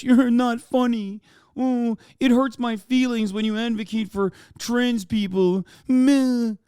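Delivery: high, whining parody voice, slow at the start; mock whiny crybaby. Streamer parodies a viewer whose feelings are hurt, whiny mocking voice. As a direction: whine like a pouty kid. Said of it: "This is what you fucking sound like".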